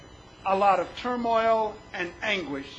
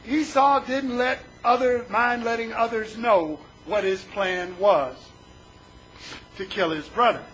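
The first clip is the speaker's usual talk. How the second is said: usual speaking voice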